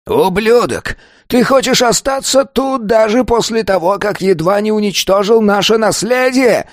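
high-pitched, raging elder; furious growling. A narrator's reading. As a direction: rage at the pupil on a strained high pitch. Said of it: "прорычал первый старейшина". (гневный рык на высоких нотах)

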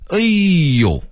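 long high cry falling away; high-pitched astonished delight; delighted exclamation. A narrator's reading. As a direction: let out an amazed, drawn-out exclamation. Old manager's delighted exclamation.

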